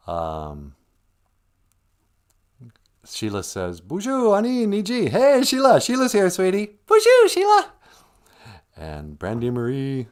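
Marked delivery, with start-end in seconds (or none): 3.8-7.7 cheery greeting. Real, not acted. Greeting chat viewers cheerfully.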